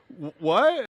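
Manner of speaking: high, mock bewildered question; disbelief. Small lifter seeing four hundred kilos, mock disbelief. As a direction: stammer in comic disbelief. Imitated lifter facing too much weight.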